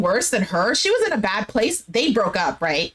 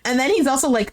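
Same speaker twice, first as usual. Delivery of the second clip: ordinary delivery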